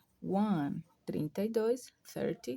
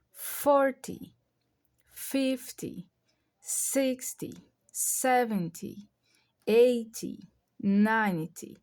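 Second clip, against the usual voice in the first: FORTY, FIFTY, SIXTY, SEVENTY, EIGHTY, NINETY with strong first syllable, falling TY; stressed first syllable; 2 semitones higher, much slower than usual. Shown as a demonstration. Teacher drills round numbers, FORTY to NINETY stressed up front. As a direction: punch the start of FORTY through NINETY, drop each TY. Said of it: "a primeira sílaba".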